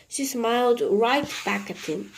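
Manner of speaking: usual speaking voice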